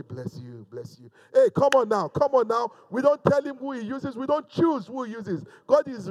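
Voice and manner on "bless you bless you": pious blessing. Retold or imitated. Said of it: "people that say".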